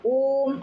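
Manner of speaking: ओम with the vowel held long; chanted stretch of ओम; prolonged ओम. Shown as a demonstration. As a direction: hold the ओ of ओम. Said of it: "ऐसे उच्चारण करते हैं".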